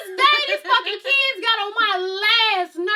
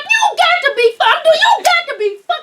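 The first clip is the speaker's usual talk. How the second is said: mock cussing; 9 semitones higher than usual. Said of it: "I start cussing like".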